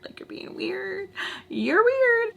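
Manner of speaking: high mocking sing-song; mock her in a squeaky voice. Mocking the friend, pitch jump.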